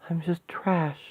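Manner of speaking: hushed, broken whisper; despairing whisper. Acted. Woman crushed by the demon's taunts, whispering despair. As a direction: give up on yourself in a whisper.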